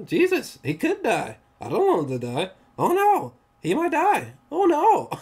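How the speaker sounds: replaying his own alarm while watching; mock panic. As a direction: act out the fear that he could die. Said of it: "I'm just like".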